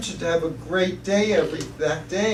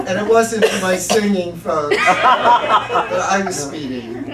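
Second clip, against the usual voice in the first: laughing while speaking; 2 semitones higher, 6 dB louder, slower than usual. Real, not acted. Laughing through the punchline.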